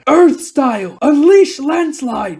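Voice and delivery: high, drawn-out attack call; battle incantation. Acted. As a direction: call out the technique slowly and forcefully. Captain casts a jutsu aloud.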